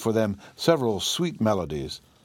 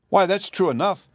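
higher, eager agreement; eager exclamation; 9 semitones higher, 4 dB louder than usual. Acted. Dorothy agrees with the Yellow Hen, pitched up eagerly. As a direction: brighten with sudden agreement. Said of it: "exclaimed Dorothy".

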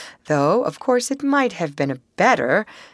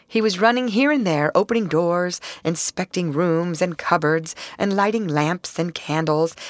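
usual speaking voice

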